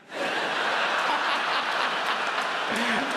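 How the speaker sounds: breathy burst of laughter at his own joke; real laugh. Real, not acted. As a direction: let the laugh break out, high and airless.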